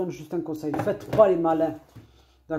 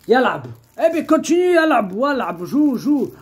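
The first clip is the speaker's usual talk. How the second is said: calling out; 6 semitones higher, 5 dB louder than usual. Real, not acted. Urging Abou to play.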